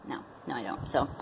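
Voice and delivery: normal talking voice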